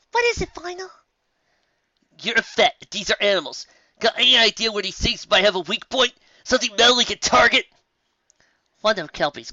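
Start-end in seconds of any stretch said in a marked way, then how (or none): 0.1-0.9 high character voice, curious; lightly voiced pony. Pony asks Final in character. 2.2-7.7 high, louder, pressing voice; urgent question. Acted. Final urges the vet for help.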